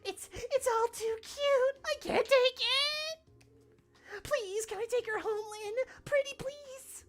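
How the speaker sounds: put-on high, drawn-out squealing anime girl; cute anime girl voice. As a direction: beg to take her home. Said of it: "Your anime girl voice is good".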